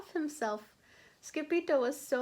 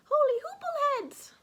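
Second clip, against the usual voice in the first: high, loud holler; 6 dB louder than usual. A narrator's reading. Scipito hollering as Martians arrive. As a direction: yell it out in alarm. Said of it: "hollered Scipito".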